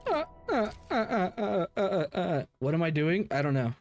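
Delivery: ordinary talk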